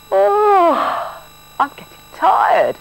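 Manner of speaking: put-on high, slow, weary girl's voice; a sleepy child sighing it out; tired little girl. A narrator's reading. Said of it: "said Josie".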